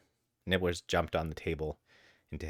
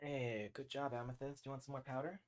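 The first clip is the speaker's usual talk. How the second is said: soft, much higher cooing at the cats; pet talk; 6 semitones higher, 15 dB quieter than usual. Real, not acted. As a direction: coo to your pets softly. Cooing at cats while feeding them.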